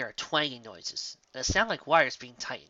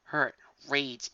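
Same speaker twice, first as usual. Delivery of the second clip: ordinary delivery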